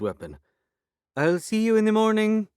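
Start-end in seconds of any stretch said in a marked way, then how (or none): 1.2-2.5 put-on high, loud Turkish accent call. A narrator's reading. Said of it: "affecting a Turkish accent".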